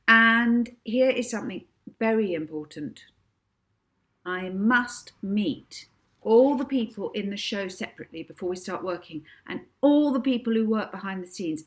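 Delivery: Molly reeling off demands, bossy. Acted. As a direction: order them about grandly. Molly issuing orders.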